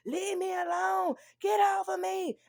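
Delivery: high, slow, shouted plea to be left alone; child screaming. Retold or imitated. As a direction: scream it like a scared seven-year-old boy. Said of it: "in the background screaming".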